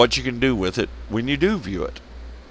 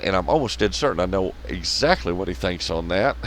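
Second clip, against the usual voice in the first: usual speaking voice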